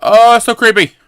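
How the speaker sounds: high, loud spooked squeal. Real, not acted. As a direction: squeal at something creepy. Spooked by creepy room.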